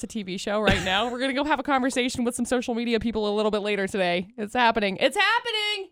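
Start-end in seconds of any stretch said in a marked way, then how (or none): 5.0-5.9 high, sing-out excitement; excited. Real, not acted. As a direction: pitch it way up with glee. Repeats phrase, second time excited.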